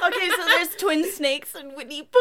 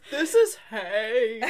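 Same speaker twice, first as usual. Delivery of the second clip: mock weird voice; 7 semitones lower, much slower than usual. Friends mimic overheard weird line.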